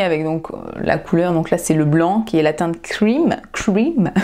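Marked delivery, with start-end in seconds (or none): 3.5-4.1 clumsy English accent. Shown as a demonstration. Retrying the shade name Cream in a self-mocking English accent. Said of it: "Quel accent de merde".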